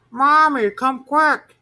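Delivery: high, loud little monster calling; little monster calling his mother. A narrator's reading. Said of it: "he called".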